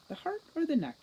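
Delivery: high, pondering the kill; gloating question. Acted. Troll weighing its victim's heart or neck, gloating. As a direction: toy with the choice. Troll musing which to bite.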